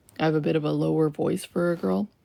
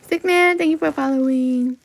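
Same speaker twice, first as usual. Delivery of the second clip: excited greeting; 6 semitones higher, 7 dB louder than usual. Real, not acted. Greets new follower on live.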